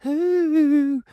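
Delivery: high, hammed-up announcer singsong; segment jingle voice. Introducing the recurring segment to listeners, hammed-up high announcer voice. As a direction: sing out the segment title like a cheesy show intro. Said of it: "No show would be complete".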